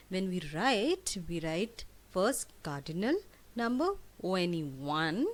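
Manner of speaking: ordinary talk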